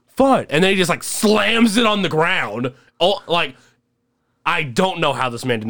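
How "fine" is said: sulky fine. Retold or imitated. Drunk friend's sulky reply.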